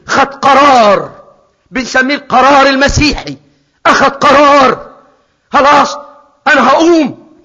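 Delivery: loud, slow and emphatic; fiery sermon call; preaching at full force. Acted. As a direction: hammer home the decision. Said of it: "preaching decision".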